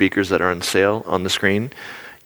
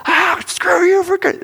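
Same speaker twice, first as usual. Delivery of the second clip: road rage yell; 4 dB louder than usual. Retold or imitated. Acting his road rage shout.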